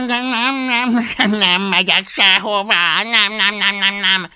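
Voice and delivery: high, loud dragon tantrum; stubborn refusal. A narrator's reading. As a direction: dig in with a rattled-off string of refusals. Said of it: "makacsolta meg magát Fogatlan".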